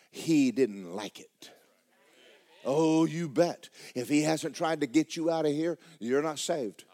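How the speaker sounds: ordinary talk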